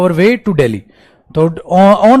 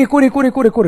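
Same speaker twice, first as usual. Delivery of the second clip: excited; 8 semitones higher, faster than usual. Real, not acted. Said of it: "excited repetition".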